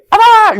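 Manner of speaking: high, loud mock kendo yell; kendo strike shout. Shown as a demonstration. Teacher demonstrating a kendo chop, sharp high shout. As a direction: yell it like a sudden sword strike. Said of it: "검도에서 끊어치기".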